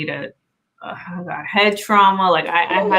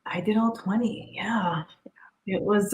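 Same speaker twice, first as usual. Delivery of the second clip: soft whisper, almost no voice; whispered confession; 9 dB quieter than usual. Real, not acted. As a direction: breathe it out like a secret. Vulnerable confession of twenty tries.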